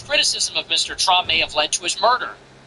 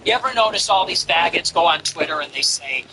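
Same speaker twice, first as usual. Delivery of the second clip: normal talking voice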